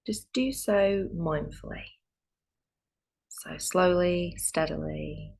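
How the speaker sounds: calm meditation guide with long pauses; guided meditation. Real, not acted. Yoga teacher easing students into Yoga Nidra, calm guided meditation. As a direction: settle them gently into stillness, leaving space between phrases.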